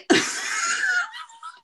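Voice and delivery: a high, shouting burst of laughter. Real, not acted. Embarrassed laughing outburst.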